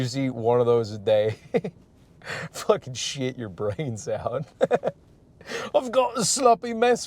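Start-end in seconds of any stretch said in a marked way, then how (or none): 5.8-7.1 high-pitched, badly faked Australian; bad Australian accent. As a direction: attempt a hammed-up Australian accent. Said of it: "I can't do Australian right now".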